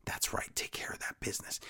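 breathy conspiratorial whisper; quietly imitating MVP. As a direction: lean in and whisper it. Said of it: "And M V P is like".

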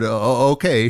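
mild nervous stutter. A narrator's reading. Flustered diner answering the waitress, stuttering. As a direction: trip lightly over the first syllable. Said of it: "but, but I just stuttered".

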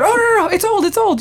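high, flustered mock panic; panicked reassurance. Friends rush to calm Monica, panicked reassurance. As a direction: frantically talk a friend down. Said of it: "they all freak out".

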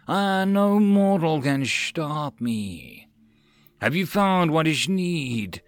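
very slow, rasping serpent voice; a sly ancient snake drawing out words; hissing serpent. Acted. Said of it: "The serpent rasped".

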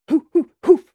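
high, loud acted "hoo" bursts; three hard drill breaths; forceful breathing whoops. A narrator's reading. Breathing drill during imagined drop.

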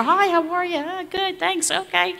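high, breezy, throwaway "Hi, how are you?"; insincere small talk. Retold or imitated. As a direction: toss it off like you don't mean it. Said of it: "you really don't mean it".

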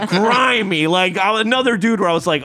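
usual speaking voice

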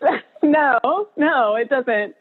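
choked up, laughing through tears; voice breaking. Real, not acted. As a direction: let the voice crack with emotion, half laughing. Emotional retelling, voice breaking.

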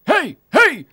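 loud, high hollered sales pitch; mock calling out. Wood chipper guy pitches weed to the brother, mock hollering. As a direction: holler it like a hammed-up hawker.

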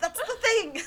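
high, loud thrilled cry; mock audience cheer. Recalling the theater crowd at Twilight, high loud cheer. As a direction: shout it out like a delighted fan. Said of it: "audience just going".

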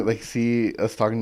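ordinary delivery

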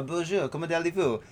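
put-on soft, proper Parisian French accent. Host showing how Parisians speak French, soft Parisian French accent. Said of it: "they speak like this".

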